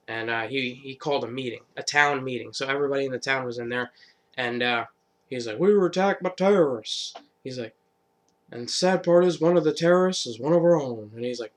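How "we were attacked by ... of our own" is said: speechmaking leader. Retold or imitated. Governor rousing the townspeople at a meeting. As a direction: stir up the crowd.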